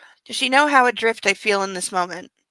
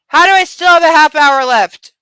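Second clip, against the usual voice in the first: loud, higher, outraged disbelief; exasperated shout; 6 semitones higher, 11 dB louder than usual. Real, not acted. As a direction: yell it at the clock, fed up. Reader dismayed at time left.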